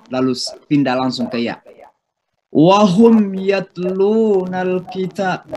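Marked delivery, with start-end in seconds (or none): 2.5-5.4 slow, high chanted recitation with ghunnah; Quran recitation. Shown as a demonstration. Teacher chants the verse for students, slow recitation.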